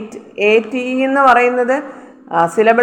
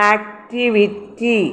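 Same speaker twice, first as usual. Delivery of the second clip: Activity slowly in separated syllables, softer; 5 dB quieter, much slower than usual. Shown as a demonstration. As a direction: break activity up syllable after syllable. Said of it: "T Y എന്നുള്ളത് ഒരു syllable".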